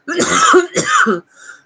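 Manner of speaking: sharp loud cough; sudden hard cough. Real, not acted. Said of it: "Excuse me".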